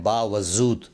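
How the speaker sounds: 'बावजूद' with z instead of j; wrong pronunciation. Shown as a demonstration. Teacher quotes the common error, mispronounced. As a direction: say 'बावजूद' as baa-wa-zood. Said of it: "गलत तलफ़ुज़ करके".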